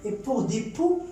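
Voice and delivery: ordinary delivery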